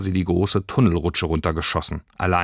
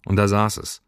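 ordinary delivery

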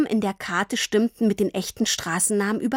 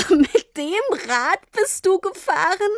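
much higher, slow incredulous question; amazed; 9 semitones higher than usual. A narrator's reading. Girl amazed her father rode the postbike, incredulous. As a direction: ask in laughing disbelief.

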